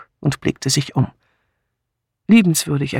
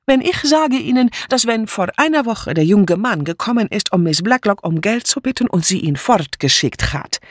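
higher, jumpy, insistent broken German; agitated refugee maid; 6 semitones higher than usual. Acted. Mitzi insisting to the inspector, agitated and defiant. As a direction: pour out the accusation, wounded.

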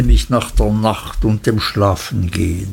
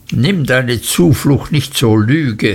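plain reading voice

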